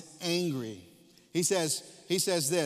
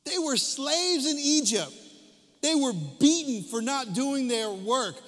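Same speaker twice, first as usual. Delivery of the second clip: louder and higher, slow on treated less than humane; full-force preaching; 7 semitones higher, 6 dB louder, slower than usual. Acted. Preacher stirring the congregation about the slaves' memories, louder. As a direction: drive home the irony.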